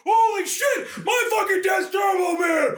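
high, loud, astonished mock shout; amazed kid. Kid discovering his dad is Turbo Man, amazed yell. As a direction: blurt it out in hammed-up astonishment. Retelling child's stunned discovery in film.

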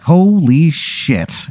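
long, reeling reaction to a disaster; stunned drawn-out exclamation. Acted. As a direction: stretch each syllable in acted shock. Acting out stunned reaction after disaster.

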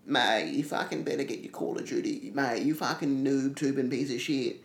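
put-on abusive online gamer in an Australian accent. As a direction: rant like an Aussie trash-talking over voice chat. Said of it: "the quintessential Australian online accent is".

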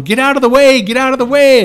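high, loud repeated shout; yelling warning. Retold or imitated. Everybody yelling at him to get off the tracks, loud high shout. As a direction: yell at someone lying on the tracks. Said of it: "like, yelling at me".

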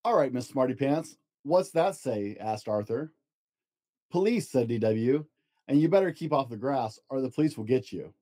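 storybook narration, gentle and lively; reading to children. Acted. Dad reads picture book to child, storybook voice. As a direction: read it aloud to a child at bedtime.

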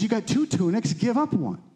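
high-pitched retold instruction; lightly voiced John the Baptist. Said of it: "He says".

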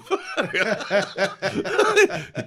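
high, loud peal of laughter; helpless loud laughing fit; real burst of laughter. Real, not acted. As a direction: burst out in a long high cackle. Laughing at own explosive remark.

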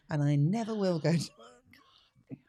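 slow, emphatic whisper; whispered aside. Real, not acted. Guest swears off baby showers, whispered. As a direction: whisper it like a private vow.

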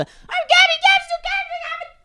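put-on distant, muffled kid voices; like a squabble heard from another room. Shown as a demonstration. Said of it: "kinda sound something like this to start from the other room".

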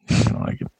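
whispered ominous repeat; whispered warning. Acted.

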